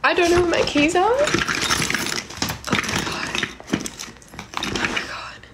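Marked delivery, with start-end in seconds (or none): 1.1-5.4 breathless whispered oh my God; frightened whisper. Real, not acted. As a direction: whisper it, terrified. Panicking while searching for keys.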